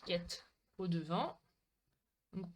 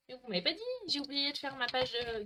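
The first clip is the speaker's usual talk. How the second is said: normal talking voice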